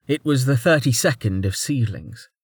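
usual speaking voice

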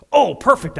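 high, loud burst of glee; gleeful. Acted. Pharisee delighted by his own scheme, gleeful burst. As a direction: pounce on the idea with delight.

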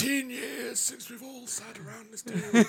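high, slow, breathy-villain parody; Voldemort. Acted. Villain addressing followers round the table, hammed-up Voldemort. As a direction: draw it out in a high mock villain voice. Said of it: "It's Voldemort".

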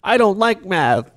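put-on high, slow childish whine; whiny kid. The son tells his father he dislikes math, high and whiny. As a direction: answer like a sulky little boy.

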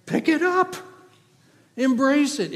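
high, loud preaching cry; a preacher urging the congregation to embrace the word; fervent urging. Real, not acted. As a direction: lift the congregation with it. Preaching what behold means.

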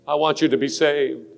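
normal talking voice

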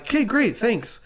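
high-pitched glib thanks; mock breezy acceptance. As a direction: imitate someone too easily satisfied. Voices people glibly accepting a turncoat.

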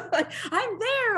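high and laughing, drawn out slowly; laughing eagerly. Retold or imitated. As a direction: giggle through it, you can't hide how keen you are. Laughing at her own eagerness.

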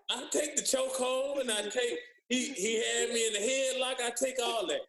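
high-pitched talk through real laughter; laughing protest. Real, not acted. Player jokes about the naked chokehold story, laughing protest. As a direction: crack up while objecting.

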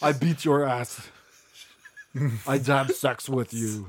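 slow mock-Russian boast; Rody impression. Performing Rody for co-hosts, slow Russian accent. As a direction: drag it out in a comic Russian accent. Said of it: "This is Rody".

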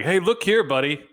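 a hammed-up restroom stranger squaring up; mock confrontation. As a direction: play the stranger picking a fight. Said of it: "had an issue with me and was like".

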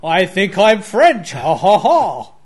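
loud mock French with a fake laugh; a hammed-up French accent, laughing. Teasing the friend's accent with a loud mock French laugh. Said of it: "What kind of accent's that?".

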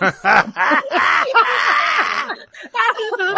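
high shrieking laugh; loud burst of laughter. Real, not acted. Laughing at goat painting.

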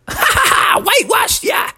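very high cackling taunt; gloating snowman cackle. A narrator's reading. Snowman taunting the raccoons, cackling gloat. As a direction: cackle and gloat like a mischievous monster.

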